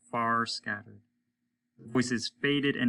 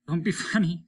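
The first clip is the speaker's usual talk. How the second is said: Stone's farewell; 7 semitones higher, 4 dB louder, faster than usual. A narrator's reading. Stone's last words, laughing.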